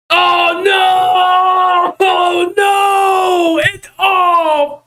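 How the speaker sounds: high, loud panicked yelling; panicked shout. Real, not acted. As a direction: yell in real panic. Blender crashed, work lost.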